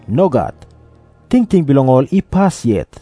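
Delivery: ordinary talk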